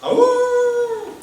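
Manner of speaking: a high, loud mock werewolf howl; wolf howl. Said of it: "he was howling".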